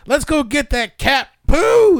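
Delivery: high, slow, loud cartoon voice; a silly high voice, drawn out slowly; goofy character voice. Voicing characters in a silly scene.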